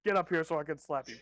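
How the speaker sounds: loud play-fighting threat; mock threat. Player mocking the slapping contest, loud mock threat. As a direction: call out a joking challenge, loudly.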